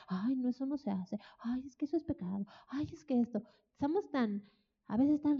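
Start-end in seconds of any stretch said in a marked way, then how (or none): 0.1-3.4 soft, fussy scolding of a holier-than-thou believer; a hint of sanctimonious tutting; mock prissy religious voice. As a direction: lightly mimic a prudish churchgoer. Mocking overly religious people.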